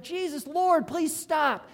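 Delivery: high, loud acted cry for help; urgent imitated plea; pleading call. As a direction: call out after someone, begging them to stop. Blind men call after Jesus.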